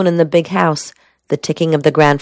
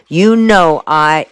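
ordinary delivery

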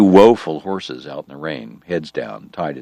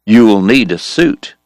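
louder stern caution; warning; 4 semitones higher, 6 dB louder than usual. Acted. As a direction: warn him firmly. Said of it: "he warned Wyatt".

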